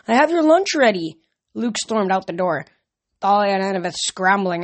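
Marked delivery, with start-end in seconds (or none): 0.1-1.2 high, loud mother's call; calling after him. A narrator's reading. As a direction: plead in a raised voice. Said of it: "called after him".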